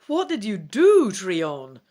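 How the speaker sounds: sharp, loud demand from behind; demanding elf. A narrator's reading. Elf Rhinath demands an answer from Trion, loud. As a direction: call out to Trion in alarm. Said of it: "Rhinath demands from behind you".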